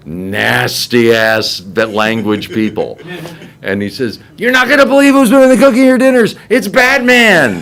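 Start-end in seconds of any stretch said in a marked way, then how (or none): 4.4-7.6 breaking the news at a shout; excited announcement. Retold or imitated. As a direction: announce huge news to a crowd. Excited announcement to dining hall.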